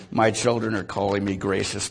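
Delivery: normal talking voice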